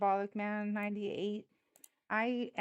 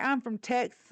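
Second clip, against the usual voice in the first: exaggerated Texas talk, mocking; mock Texas accent; 4 semitones higher, 6 dB louder than usual. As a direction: imitate a fake Texan accent. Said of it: "when they start trying to talk".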